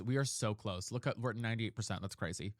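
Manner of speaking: normal talking voice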